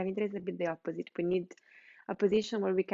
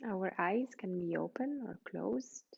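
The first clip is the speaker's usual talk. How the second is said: guided meditation; 4 dB quieter than usual. Real, not acted. Yoga teacher settling students lying on the floor, guided meditation. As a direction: guide them gently into rest, leaving space between phrases.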